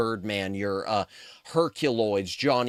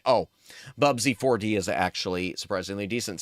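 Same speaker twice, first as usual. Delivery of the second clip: ordinary talk, nothing marked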